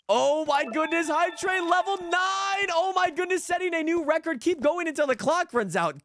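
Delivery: shouting, high and hyped; hoarse sleep deprived hype. Real, not acted. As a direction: yell it out in celebration. Hype train record hit.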